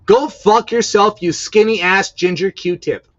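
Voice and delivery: loud, high, angry yelling; furious shout. Real, not acted. Hurling insults at the singer, furious loud shout. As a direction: yell the insult in real fury. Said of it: "tell me how you really feel".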